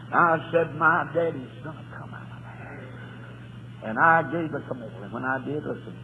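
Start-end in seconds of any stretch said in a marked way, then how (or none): 0.7-3.9 slow, hushed promise; whisper. Real, not acted. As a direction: whisper it slowly at the grave.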